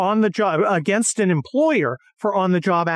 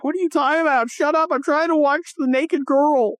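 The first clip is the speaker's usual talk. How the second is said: higher, dismissive mock voice; imitating Maria; 7 semitones higher than usual. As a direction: brush him off in a put-on voice. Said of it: "And Maria's like".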